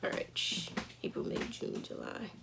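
whispered counting to herself; whispering. Real, not acted. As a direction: count the months under your breath. Counting months under breath.

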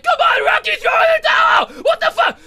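very high, loud mock-female shriek; screaming wife. Apollo's wife begs Rocky to stop the fight, screaming. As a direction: scream it hammed-up as a panicking wife. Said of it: "his wife is like".

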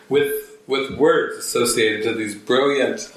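normal talking voice

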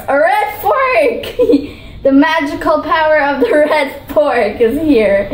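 laughing through the words; giggling. Real, not acted. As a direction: giggle while showing off the fork. Giggles showing off fork.

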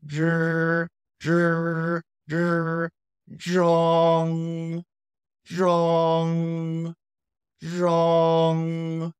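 ZH buzzed long and high, then ZHONG; loud, sustained high ZH and ZHONG; ZH pronunciation. Shown as a demonstration. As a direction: sound out ZH alone, then hold ZHONG high and level. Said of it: "pronunciate the Z H".

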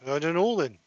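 usual speaking voice

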